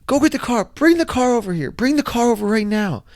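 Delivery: high, urgent mock yell; retold yell. Man retelling his yell to his wife to bring the car, high mock yell. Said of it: "So I yell to my wife".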